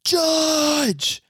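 high-pitched mock scream for the judge; retold scream. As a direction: draw out one high, hammed-up call. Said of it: "he just screams as loud as he can".

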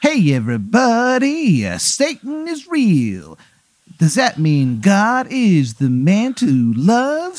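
high, drawn-out ghostly radio announcer; creepy radio host. Acted. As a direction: croon it like a spooky DJ from beyond. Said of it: "the song keeps playing".

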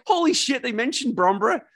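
hearing your home town named in a game; excited disbelief. Retold or imitated. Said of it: "I was like".

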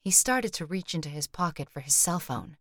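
ordinary delivery, nothing marked

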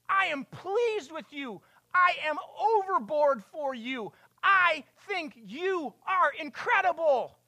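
high, loud, slow loving declaration; voice of God. Acted. God speaks to his son Jesus, high and loving. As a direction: pour love over him as the Father. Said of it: "voice came from heaven".

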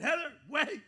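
high, loud call after his wife; urgent call. Retold or imitated. Husband calls after wife at the garage, loud urgent shout. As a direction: shout her name to stop her. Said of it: "And I said".

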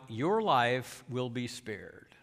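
slow, low, softened royal vow; understated, measured retold promise; solemn vow. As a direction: pronounce mercy slowly and quietly. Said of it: "David vowed".

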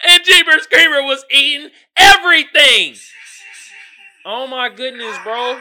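laughing shout. Real, not acted. Telling viewers about the monster, laughing shout. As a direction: yell it while cracking up.

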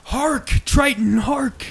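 a high, slow, shouted Hark, Triton; big hark. Pretend. Friends acting the sea curse, a high mock shout. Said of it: "this is the big hark".

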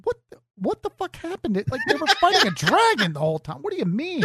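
high-pitched laughing disbelief; mock incredulous. Viewer answering weepy fan comments, mock bewilderment. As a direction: squeak it out, baffled and amused.

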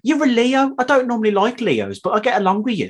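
strong Birmingham accent; Brummie accent. Real, not acted. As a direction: retell it in broad Brummie. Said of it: "one of the brummiest things".

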